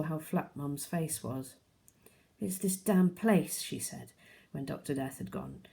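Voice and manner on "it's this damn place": Mum bitter and worn out, slower; upset mum. A narrator's reading. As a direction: say it slowly, fed up with this place. Mum upset about the house.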